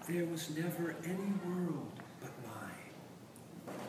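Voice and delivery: cooing witch, hushed and slow; soft enchanting witch. A narrator's reading. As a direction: lull them like a spell. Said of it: "The witch's voice came cooing softly".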